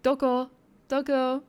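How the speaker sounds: lightly sing-song call of "Doko" to a cat. Retold or imitated. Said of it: "I end up calling to my cat".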